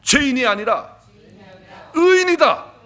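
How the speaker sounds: building declaration. Shown as a demonstration. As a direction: start firm and climb to a ringing shout. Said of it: "한번 선포하겠습니다".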